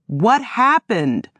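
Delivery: slow, urgent mock shout. A narrator's reading. Woman demands news from Otis in the hospital, slow mild shout. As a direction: demand it, drawn out. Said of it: "I shouted".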